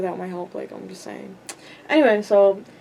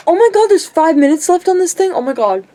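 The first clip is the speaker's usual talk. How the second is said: sudden loud alarmed gasp of surprise; real surprise; 7 semitones higher, 7 dB louder than usual. Real, not acted. Vlogger suddenly noticing the battery is almost dead, loud surprise. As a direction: blurt it out, shocked at the battery.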